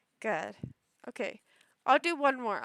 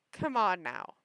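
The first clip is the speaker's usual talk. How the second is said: ordinary talk, nothing marked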